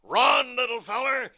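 big cartoon bulldog urging, high and urgent; Chopper. Bulldog tells the little duck to flee, cartoon bulldog voice. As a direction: call out as a protective cartoon bulldog. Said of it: "Chopper tells him to run".

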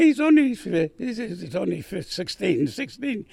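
flustered, stumbling high voice; stammering mother. Retold or imitated. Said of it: "she told me".

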